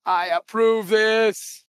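drawn out, every word stretched; slow solemn approval. Acted. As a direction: stretch it out like a verdict.

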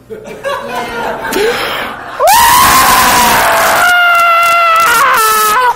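sobbing wail. Real, not acted. Preacher retelling his breakdown before God, real sobbing wail. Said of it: "That's what I did".

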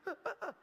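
high, light pretend laughter; high mock giggling after the ride; acted rider's laugh. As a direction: laugh like a rider coming off the coaster. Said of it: "Y se baja así".

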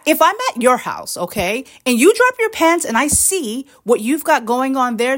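ordinary talk

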